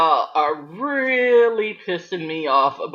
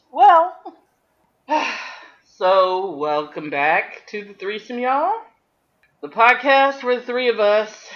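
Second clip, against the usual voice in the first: podcast host intro; 6 semitones higher than usual. Acted.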